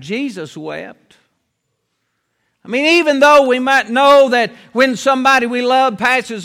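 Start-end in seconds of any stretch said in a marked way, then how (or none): 0.0-1.2 hushed solemn. Acted. Climax on Jesus weeping.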